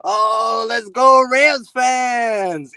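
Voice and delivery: mock stadium announcer yell, high and drawn out; screaming announcer. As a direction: imitate an over-the-top hype announcer, stretching the words. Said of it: "and he's screaming".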